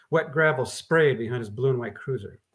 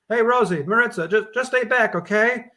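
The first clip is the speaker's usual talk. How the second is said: calls out firm orders to the women, louder and higher; ordering; 8 semitones higher, 5 dB louder than usual. Acted. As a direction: hold them back with a raised voice. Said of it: "Gordon ordered".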